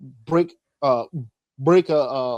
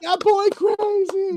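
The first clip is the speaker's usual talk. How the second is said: awed; slower than usual. Pretend. Mock amazed reaction.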